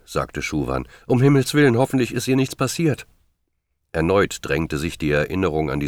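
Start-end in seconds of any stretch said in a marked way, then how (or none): 1.1-3.1 high, anxious exclamation, loud at the start; alarmed worry. A narrator's reading. Schuwan fearing Rose has fallen, alarmed and high-pitched. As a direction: cry out in dread for someone missing. Narrated character fearing for missing girl.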